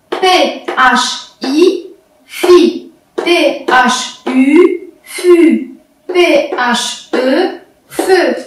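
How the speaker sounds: letters one by one with pauses, then the joined syllable; teacher's measured syllable drill; syllabic reading. Shown as a demonstration. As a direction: spell out each sound, then blend it. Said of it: "On passe maintenant à la lecture syllabique".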